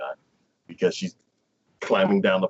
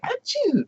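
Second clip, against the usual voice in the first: high, cute mock sneeze; a tiny squeaky achoo. Girl sneezing, voiced.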